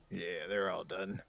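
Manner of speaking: tired and slow, just woken up; exhausted. Real, not acted. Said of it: "you sound exhausted right now".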